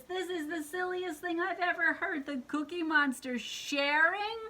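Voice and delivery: put-on high, incredulous storybook girl; high-voiced scoffing at a silly idea; Betty Lou character voice. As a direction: pitch it up, end on a disbelieving question. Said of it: "She says".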